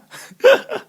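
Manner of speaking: squealing laugh, high and loud; high burst of laughter. Real, not acted. Laughs after the mock rant.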